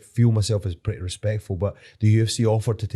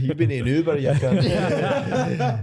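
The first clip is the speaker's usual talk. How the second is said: teasing put-on voice, higher; jokey higher put-on voice; mocking voice; 6 semitones higher, much slower than usual. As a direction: mimic the friend with a grin. Mocking a friend's line.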